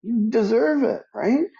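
retold pep talk to Judy, high and insistent; emphatic encouragement. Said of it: "I go".

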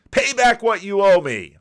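angry creditor's demand. Acted. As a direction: bark the order at the debtor. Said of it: "he demanded".

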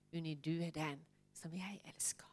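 hushed, tender whisper of a loving father. Retold or imitated. Preacher voicing God's love to her, in a whisper. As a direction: breathe it softly like a secret of love.

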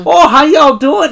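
high, drawn-out mock Midwestern accent; Erin. Podcaster mimics receptionist sounding like a cartoon mom, Midwestern accent. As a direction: imitate a Fargo mom, slow and sing-song Midwestern. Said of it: "she sounds like Bobby's mom".

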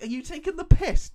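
high, loud laughing question; laughing disbelief. Real, not acted.

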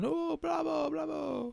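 high, soft mock cheer; high, held-back cries of applause; cheering audience. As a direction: imitate a distant theatre crowd cheering. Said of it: "People would have been clapping".

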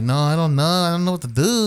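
mock a flustered driver's foreign accent; exaggerated second-language English accent. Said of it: "English was not his first language".

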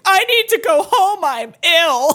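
overplaying her illness for her friends; dramatic. Telling her friends she's ill with mock drama. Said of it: "like, dramatically".

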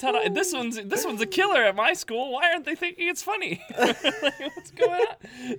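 high, loud laughing disbelief; bubbling over at the flop; excited retelling. Retold or imitated. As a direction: joke bombs, high laughing bewilderment. Retelling her joke falling flat.

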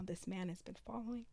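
burp slips out mid-phrase; words pushed through a burp. Real, not acted. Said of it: "Where did burp come from?".

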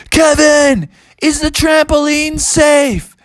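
high, drawn-out cartoon holler; calling down. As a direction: call it out high from up in the air. Bubby calls down while circling.

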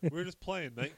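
high-pitched mock Australian accent; Australian kangaroo. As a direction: chime in as a cartoon Australian kangaroo. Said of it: "The kangaroo's like".

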